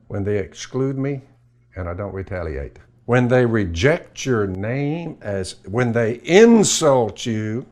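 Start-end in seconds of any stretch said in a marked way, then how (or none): 3.0-7.7 slow and building to louder on "insult"; slow rising sermon cadence; slow preaching build. Real, not acted.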